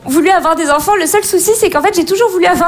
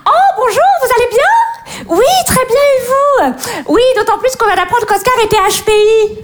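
high, gushing polite greeting; competitive mum; 4 semitones higher than usual. Acted. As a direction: play the show-off mother chirping hello. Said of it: "les mamans compètes".

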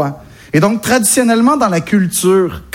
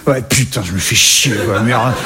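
put-on very low, grumbled caricature Parisian accent; imitation d'accent parisien; 11 semitones lower than usual. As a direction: sink your pitch right down and mock a typical Parisian. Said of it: "C'est comme ça qu'il sonne le parisien de base pour moi". (accent parisien caricatural, très grave et râleur)

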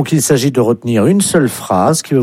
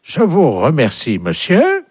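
French in a mock English accent, rising high at the end; put-on English caller; 4 semitones higher than usual. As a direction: thank him as an Englishman speaking French. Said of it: "vous serez appelé par un Anglais". (faux accent anglais, finale aiguë)

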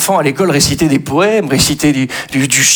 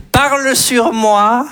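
slow, higher dictation voice; 6 semitones higher, slower than usual. Pretend. Teacher dictating song lyrics to the class, slow. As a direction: spell it out like a schoolteacher.

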